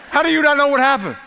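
high, loud, disbelieving question; incredulous retort. Retold or imitated. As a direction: throw the question back in mock disbelief. Preacher retorts to pregnant teens.

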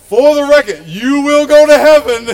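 fix the slip with force; emphatic correction. Real, not acted. Pastor loudly correcting himself before the congregation.

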